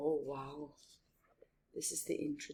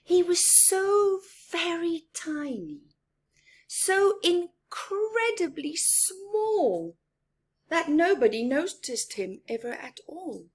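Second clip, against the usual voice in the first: much higher, sing-song reading for little ones; storybook narration; 9 semitones higher, 8 dB louder than usual. A narrator's reading. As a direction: make the mouse sound tiny and sweet. Picture book read aloud.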